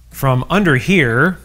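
usual speaking voice

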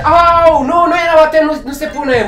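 yelp, then object urgently; pained protest. Real, not acted. Player hit by the ball claiming it doesn't count, high flustered protest.

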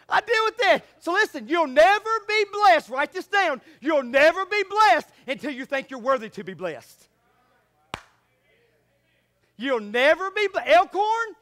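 loud building preacher cadence; rising fiery sermon; full-force preaching. Real, not acted.

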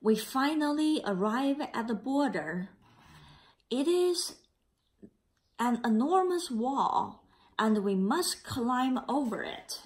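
put-on a gentle read-aloud for children; story narration. A narrator's reading. Teacher reading the class a picture book, gentle narration.

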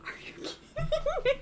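high, soft, breaking into laughter; laughing disbelief. Real, not acted. Streamer who can't believe the luck, laughing in disbelief. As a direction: squeak it out through helpless laughter.

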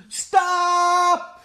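high, loud drawn-out stop; long desperate shout; yell. Acted. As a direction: yell it to halt the pot. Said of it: "yelled Big Anthony".

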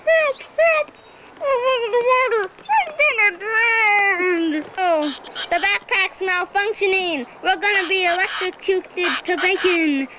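mock terror, campers going under; panicked cries. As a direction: scream like you're drowning in a cartoon.

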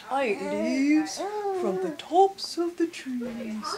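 giraffe voice. Acted. Giraffe answers after clearing throat.

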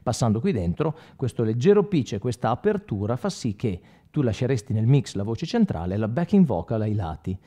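ordinary delivery

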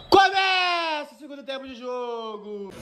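ordinary talk

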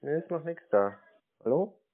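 normal talking voice

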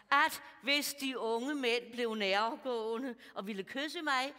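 normal talking voice

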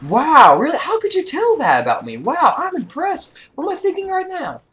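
very high, slow, mock-amazed voice; sarcastic fake awe. Mocking the trash-talking party, high sarcastic awe. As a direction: gush with fake wonder to mock them. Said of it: "kind of do a Woody's Gamer Tag thing".

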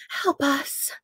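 put-on high, faint, pleading old voice; a distant old ghost begging for help; desperate elderly voice. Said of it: "a desperate elderly voice".

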